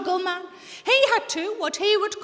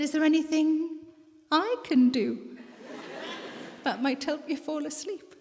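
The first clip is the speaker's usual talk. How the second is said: slow, drawn-out mock flirtation; hammed-up coquettish offer; 3 dB quieter than usual. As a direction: stretch it out coyly, playing seductive. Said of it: "in my most coquettish voice".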